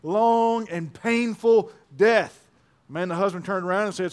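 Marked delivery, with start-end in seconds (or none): 0.0-2.4 mock yell, drawn out word by word; slow, mildly raised yell of an imitated wife; retold yell. Wife to her departing husband in a joke, slow mock yell. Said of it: "she yells out to her husband".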